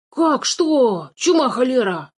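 high, outraged cry; indignant exclamation. A narrator's reading. Dwarf appalled at a boy refusing gold, indignant. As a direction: splutter it in high-pitched indignation. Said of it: "подпрыгнув от негодования, воскликнул карлик".

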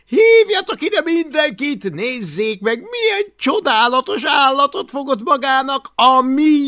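very high, loud gleeful jeer; mocking delight. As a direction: crow over the find. Takonypóc kigúnyolja a sárkányt. (magas, harsány kárörvendés)

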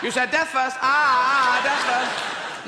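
loud, high, slow triumphant gloat; gleeful gotcha. Retold or imitated. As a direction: pounce on the slip with glee. Inquisitor catches him out.

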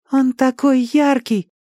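put-on high, slow child's voice; entranced boy. A narrator's reading. Danny gazing at the unearthly glow, high entranced voice. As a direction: marvel slowly like a small boy.

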